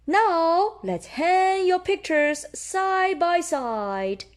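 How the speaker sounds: high, slow gentle teacher; high slow voice of a kind teacher. Acted. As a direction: guide the class sweetly. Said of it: "Madame Gazelle says".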